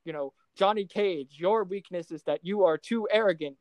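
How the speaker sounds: usual speaking voice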